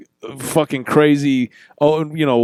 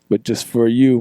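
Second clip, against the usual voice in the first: usual speaking voice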